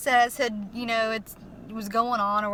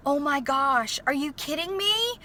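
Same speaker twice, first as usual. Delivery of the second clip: amazed disbelief; 3 semitones higher than usual. Retold or imitated. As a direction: retell being stunned by good news. Reliving disbelief at loan approval.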